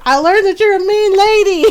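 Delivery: high, loud, hurt protest; hammed-up tearful complaint; mock whine. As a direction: imitate a wailing, aggrieved son. Spanked brother answers back to mom.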